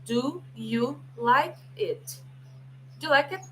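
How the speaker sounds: first every word apart, then run together; careful then linked. Shown as a demonstration. Teacher contrasting careful and linked speech. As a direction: Do you like it slow and separate, then blended. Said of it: "o que você acabou de falar".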